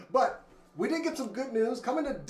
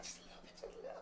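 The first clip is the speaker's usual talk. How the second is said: whispering; 22 dB quieter, much faster than usual. Real, not acted. Repeats phrase in whisper.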